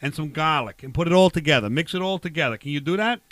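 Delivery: put-on broad Boston accent with dropped r's. Barbecue guy giving co-host a recipe, Boston accent. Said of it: "use a Boston accent".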